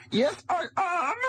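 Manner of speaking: mock Nazi actress. Acted Nazi actress game show.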